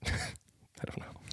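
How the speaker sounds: unvoiced whisper, laughing. Real, not acted. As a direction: breathe it out in a whisper.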